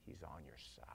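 whisper. Real, not acted. Secret told to congregation.

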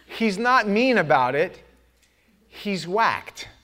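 ordinary talk

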